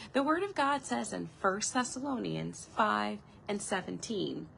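ordinary delivery

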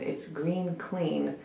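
hushed, not to wake anyone; quiet voice. Real, not acted. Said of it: "Everyone is asleep".

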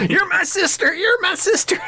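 shrill shout, way up high; high screaming quote. Retold or imitated. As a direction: scream it like a movie line. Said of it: "Like the scene from Joe Dirt".

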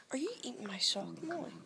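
voiceless whisper. Real, not acted. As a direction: whisper to the dog. Whispering to the dog.

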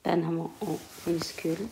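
murmuré lentement, mot après mot, en écrivant au tableau; à voix basse en écrivant. Real, not acted. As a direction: dicte tout bas pour soi, avec de longues pauses. Dictates softly while writing on board. (la maîtresse écrit au tableau et se dicte les mots, à voix basse)

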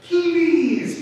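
high, loud drawn-out plea; begging. A narrator's reading. Bird pleads with reluctant elephant, begging. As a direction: beg with one long please. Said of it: "begged the bird".

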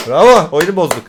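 mock cheer. Real, not acted. Ironic cheer after breaking game.

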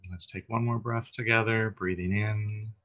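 calm, unhurried meditation guide, long pauses between phrases; guided meditation. Real, not acted. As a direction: gently lead listeners through breath and body, lingering on phrase ends.

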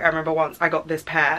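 normal talking voice

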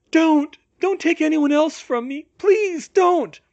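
high, loud, desperate plea; terrified babbling. A narrator's reading. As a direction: beg in terror, voice shooting up. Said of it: "babbling incoherently in terror".

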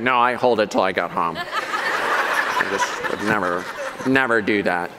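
a lightly voiced prissy denier, low and laughing; mock-prim insistence, half laughing; prim refusal. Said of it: "Some of you are like".

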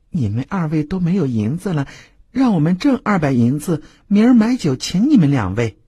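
high sweet cajoling voice; lightly voiced flirt; coaxing courtesan. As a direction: sweet-talk the broke guests. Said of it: "低低的说道".

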